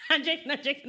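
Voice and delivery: loud laughing protest; laughing shout. Real, not acted.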